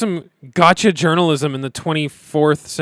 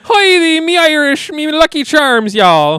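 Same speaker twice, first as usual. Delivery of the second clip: hammed-up stage Irish, much higher and louder; mock Irish accent; 12 dB louder, slower than usual. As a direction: lampoon a leprechaun brogue. Parodying Romulan's Irish accent.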